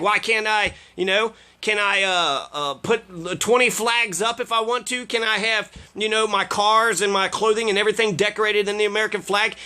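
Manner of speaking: normal talking voice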